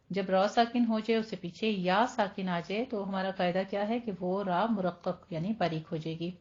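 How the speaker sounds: ordinary talk, nothing marked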